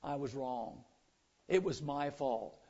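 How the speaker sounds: a humble churchgoer quietly admitting fault; humble quiet confession. Shown as a demonstration. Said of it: "are you humble enough to say".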